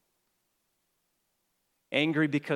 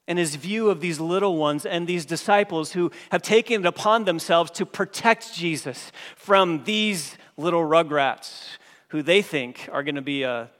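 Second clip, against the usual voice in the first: usual speaking voice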